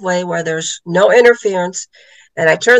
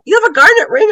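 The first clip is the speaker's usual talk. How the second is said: surprised question; 12 semitones higher, 4 dB louder, faster than usual. Retold or imitated. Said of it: "friend's surprised question retold".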